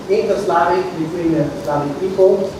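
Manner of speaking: usual speaking voice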